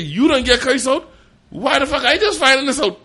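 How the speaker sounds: high and loud, fed up; exasperated shout. Retold or imitated. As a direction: demand an answer loudly. Shouts at the friend.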